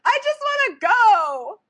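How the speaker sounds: high, slow, drawn-out imitation; hammed-up tearful plea to leave; mock whiny wail. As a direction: stretch it into a high whine. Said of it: "Ashley starts going".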